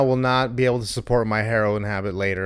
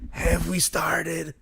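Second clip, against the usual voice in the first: slow, softer Brooklyn character voice; Dawn; 2 semitones higher, 7 dB quieter, much slower than usual. Shown as a demonstration. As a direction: drag the question out in a put-on Brooklyn voice. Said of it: "That's my Dawn impression".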